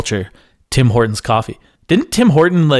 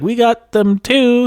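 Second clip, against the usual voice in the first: a lightly voiced smug chain, higher and slow; mock smug brand voice; 11 semitones higher, much slower than usual. Teasing the chain that brags about its chicken, lightly smug and slow. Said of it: "But Tim Hortons, like".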